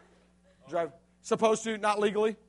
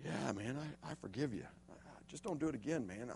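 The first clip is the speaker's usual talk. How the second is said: much softer and lower, gently letting it go; soft forgiving; 8 semitones lower, 18 dB quieter than usual. Retold or imitated. [name] forgiving Josh from the ground, soft and low. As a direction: forgive him quietly, still dazed. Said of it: "And I'm like".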